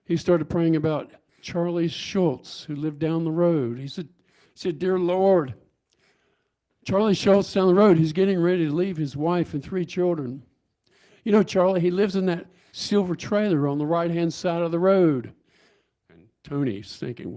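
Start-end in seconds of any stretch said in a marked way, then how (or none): 4.8-15.3 loud, raised, imploring public prayer; imitated earnest prayer. Congregant praying aloud about Charlie Schultz, loud imitated prayer. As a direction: pray aloud over the room, loud and higher. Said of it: "started praying about something entirely different".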